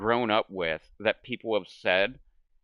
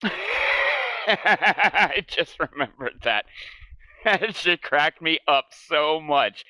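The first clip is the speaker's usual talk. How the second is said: laughing through the words, slowly; 6 semitones higher, 5 dB louder, much slower than usual. Real, not acted. To the camera, recalling a friend's joke, laughing. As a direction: crack up as the memory hits.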